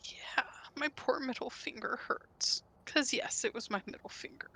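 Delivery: hushed whimpering whisper; whispering in pain. Real, not acted. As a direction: whisper about the hurt finger. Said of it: "how I deal with pain".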